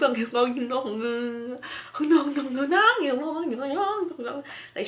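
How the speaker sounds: high mocking laugh at the wig; mocking cackle. Cashier laughing at the wig, imitated mocking cackle.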